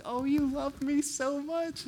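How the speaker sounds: high, slow, gushing; gushing delight. Retold or imitated. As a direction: melt with delight at being loved. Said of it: "They're like".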